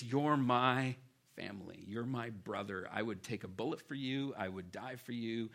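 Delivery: mean every word, like family; deep heartfelt affection. Retold or imitated. Pastor voicing Paul's devotion to a brother, tender and earnest. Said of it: "Don't lose that tone".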